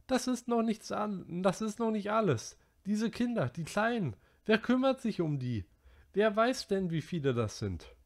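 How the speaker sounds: put-on high boy's voice, worked up; Piggy scolding. A narrator's reading. Piggy berating the boys over the island fire, high and worked up. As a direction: rant at the careless boys.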